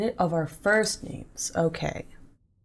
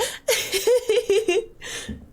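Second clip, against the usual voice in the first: squealing laugh; high laugh. Real, not acted. Laughing at chat redeems.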